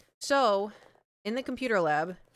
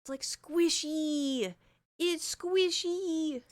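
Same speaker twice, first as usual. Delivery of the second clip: high, slow, cooing over the mic; cutesy squishy; 8 semitones higher, much slower than usual. Real, not acted. Streamer squeezing her new microphone, high slow coo.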